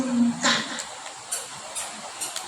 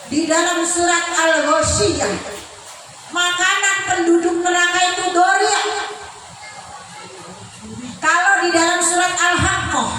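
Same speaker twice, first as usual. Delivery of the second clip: usual speaking voice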